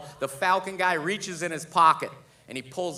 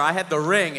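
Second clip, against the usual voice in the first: usual speaking voice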